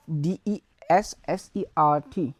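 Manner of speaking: normal talking voice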